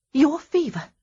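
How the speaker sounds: high, loud startled cry; sudden exclamation. Acted. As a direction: blurt it out as it hits you. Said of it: "she suddenly exclaimed".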